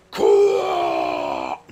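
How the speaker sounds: low booming shout. Pretend. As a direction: blurt the word out loudly. Punchline shout after quote.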